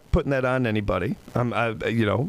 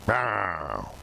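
low, soft gruff meow; pirate cat meow; 3 semitones lower, 4 dB quieter than usual. Pretend. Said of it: "I was trying to say walk the plank".

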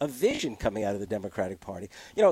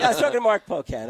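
laughing; 9 semitones higher, 5 dB louder, faster than usual. Real, not acted. Caught out, laughing it off.

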